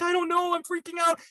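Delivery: high-pitched imitation of someone panicking; lightly acted rising panic on the phone; retold freaking out. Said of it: "And so she's freaking out".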